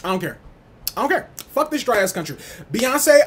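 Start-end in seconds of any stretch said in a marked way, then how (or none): 2.7-3.2 loud high shout of a name; excited shout. Real, not acted. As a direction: cheer her name. Calling out her name.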